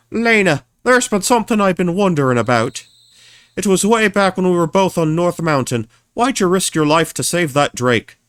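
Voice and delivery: put-on young heroine voice. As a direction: voice the princess. Voices character dialogue.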